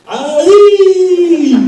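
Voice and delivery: sudden high, loud whooping yell; war whoop. Retold or imitated. Coach running onto the field, loud laughing war whoop. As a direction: let rip a big war cry, laughing through it. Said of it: "and goes".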